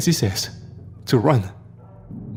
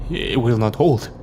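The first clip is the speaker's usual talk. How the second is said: usual speaking voice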